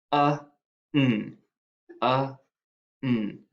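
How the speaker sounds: uh, n, each sound said slowly apart. Shown as a demonstration. Teacher sounding out each sound for dictation. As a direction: stretch out uh then n for the kids. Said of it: "sounding out syllable".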